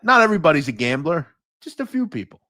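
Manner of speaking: ordinary talk, nothing marked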